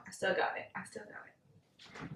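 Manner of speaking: breathy self-satisfied whisper. Real, not acted. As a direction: whisper it to yourself, pleased.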